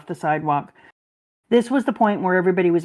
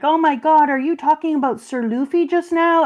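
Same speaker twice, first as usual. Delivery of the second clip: imitated excitement; 6 semitones higher than usual. Retelling friends chatting about Luffy, imitated excitement. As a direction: act out eager new friends, pitched up. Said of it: "she's so excited".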